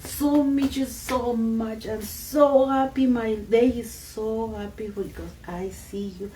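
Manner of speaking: high, loud, slow delighted greeting; joyful greeting. Retold or imitated. Elder greeting the mountain, slow and overjoyed. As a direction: greet an old friend with open joy, unhurried. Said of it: "she start greeting".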